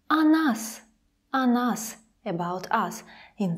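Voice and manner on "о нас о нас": "О нас" with о as a clear, open a. Shown as a demonstration. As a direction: say "О нас" like "a nas". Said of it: "it sounds more like a".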